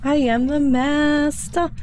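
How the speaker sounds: high, slow gleeful boast; gleeful cheer. Real, not acted. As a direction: crow about it gleefully. Celebrating finding orbs.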